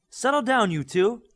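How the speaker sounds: high, loud yell over the quarrel. A narrator's reading. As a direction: shout them both down at once. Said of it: "Bioforce yelled".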